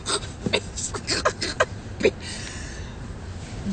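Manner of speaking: long wheezing, voiceless laugh; breathy laughing fit. Real, not acted. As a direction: crack up helplessly at your own story. Breaks up at own story.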